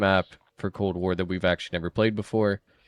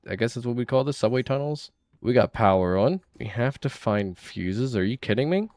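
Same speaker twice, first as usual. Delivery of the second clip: ordinary talk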